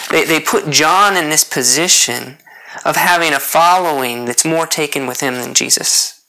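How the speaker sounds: ordinary talk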